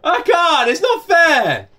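rising from firm to near-shouted, high and loud, with a broken laugh; building upset protest. Real, not acted. Father of two begging the song to stop, building to a shout.

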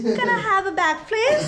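high, rising copy of "Can I have a bag, please?"; attempted British accent. Auntie copies the vlogger's Essex request, attempting the accent. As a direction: try the Essex request, going up at the end. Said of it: "Go, go".

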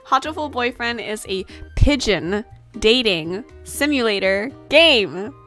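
usual speaking voice

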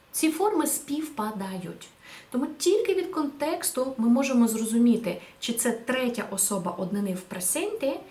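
ordinary talk, nothing marked

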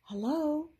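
usual speaking voice

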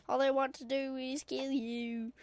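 ordinary delivery

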